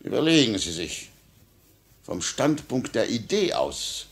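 ordinary talk, nothing marked

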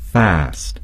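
American English fast, with a flat a as in cat; American pronunciation of fast. Shown as a demonstration. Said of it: "and Americans would say".

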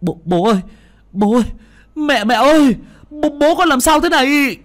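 high, loud desperate calling; panicked cries. A narrator's reading. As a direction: cry out in panic. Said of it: "kêu lên".

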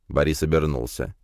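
usual speaking voice

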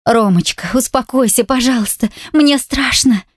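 a frightened wife begging her husband; quiet frightened plea. A narrator's reading. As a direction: beg him to calm down, voice lifted in fear. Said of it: "тихо прошу".

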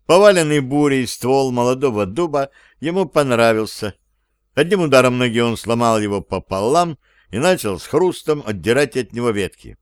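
plain reading voice

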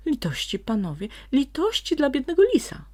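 high, loud wailing plea; lamenting fox. A narrator's reading. Frightened fox wails to his captors for mercy. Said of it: "lamentował rudzielec".

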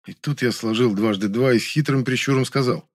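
usual speaking voice

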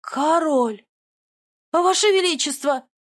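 high, loud frantic exclamation; frantic cry. Acted. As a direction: blurt it out in a nervous panic. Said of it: "задёргался опять Мракиан".

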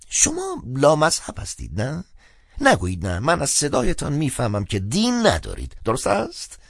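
high-pitched, insistent clergyman; chatty phone priest, probing. Acted. Priest guesses the caller is godless, probing. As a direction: press the questions in a high, lively voice.

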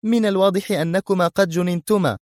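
high, agitated scolding; high-voiced irritable outburst. A narrator's reading. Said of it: "في عصبية زائدة".

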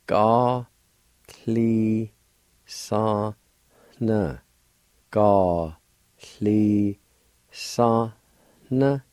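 Gá, clí, san, na said slowly one syllable at a time. Shown as a demonstration. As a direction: break the word into clear syllables. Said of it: "Pronounce like this".

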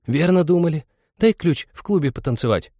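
put-on high girlish wheedling; coaxing village girl; pleading girl. A narrator's reading. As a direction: beg for a favour in a high girl's voice. Girls beg for club key.

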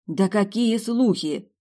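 high, loud, drawn-out protest; puzzled indignation. A narrator's reading. Heroine objects to guard's talk of rumours, indignant. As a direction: bristle in disbelief, slowly stretching the question. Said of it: "недоуменно возмутилась я".

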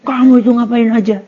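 loud, high accusing scold; harsh scolding. Shown as a demonstration. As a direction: tell him off loudly. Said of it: "Tapi kalau caranya gimana?". (omelan keras dan tinggi)

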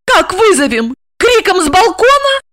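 high-pitched female outcry; frantic, high questions; hysterical wail. A narrator's reading. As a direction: cry out in exasperation. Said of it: "завопила Ольга".

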